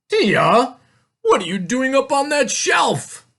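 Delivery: high, loud, incredulous question; astonished father. A narrator's reading. Father finds Tia on the shelf, astonished. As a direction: exclaim it in disbelief.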